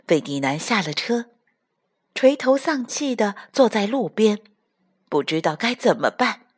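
usual speaking voice

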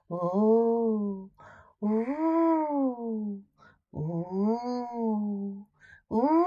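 soft wavering "wooo" of wind; wind howl. A narrator's reading. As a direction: imitate the wind starting to blow, softly. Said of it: "the wind began to blow".

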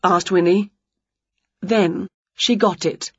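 ordinary delivery, nothing marked

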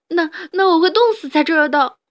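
high, loud sobbing wail; crying. Acted. The trapped rabbit cries to the badger, sobbing. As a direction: sob it out, stumbling on the first word. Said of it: "哈布斯边哭边说".

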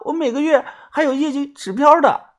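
high plaintive voice, falling on 标; 业绩指标 said high and sorry for herself; pleading girl.

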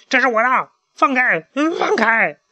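high, loud frantic yelling; frantic shout. Acted. Chick fighting the invisible chaser, frantic shout. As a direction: cry out desperately to let go. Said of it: "小胖墩儿急得大喊起来".

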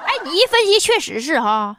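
normal talking voice

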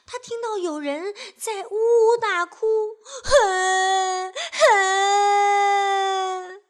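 high, loud hammed-up sobbing; exaggerated crying; whimpering sobs. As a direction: sob like a sulking child.

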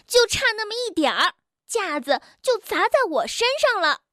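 high-pitched, rapid-fire indignant complaint; angry. A narrator's reading. Bengbeng scolds Tiaotiao after a near accident, angry. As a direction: snap it out fast and high, cross. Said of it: "蹦蹦生气地说".

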